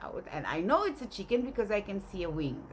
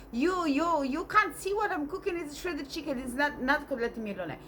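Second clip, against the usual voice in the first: high-pitched mock tirade; indignant scolding; 7 semitones higher than usual. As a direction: act out grandmother telling him off. Grandmother quarrels with granddad.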